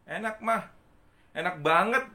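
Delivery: put-on a kid loving mum's cake; delighted child. Child voiced in story. (anak kecil senang, suara lebih tinggi)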